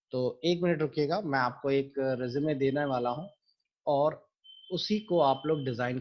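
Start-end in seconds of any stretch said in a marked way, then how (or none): none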